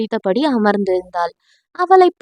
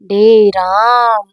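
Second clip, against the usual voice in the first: slow, drawn-out tipsy call; slurred drunk call; drunk drawl; 4 semitones lower, 3 dB louder, much slower than usual. Acted. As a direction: drag the name out like she's drunk. Drunk girl calls him slowly.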